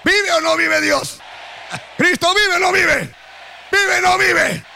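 de firme a gritado, cada vez más alto; grito creciente. Real, not acted. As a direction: sube la voz en cada pregunta hasta gritar. Predicador interpela a la congregación. (el pastor pregunta a la iglesia, gritando cada vez más)

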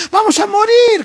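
friend's sudden high loud outburst; exasperated shout. Acted. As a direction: yell it at the grieving man. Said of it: "como le están atajando".

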